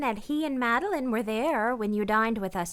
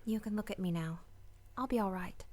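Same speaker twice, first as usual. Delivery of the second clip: quiet, brave; 11 dB quieter, faster than usual. Acted. Tender farewell.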